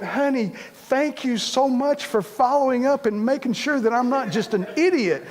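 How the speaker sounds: mock sweet thanks. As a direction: pretend gratitude that is really irritation. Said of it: "No, I got mad".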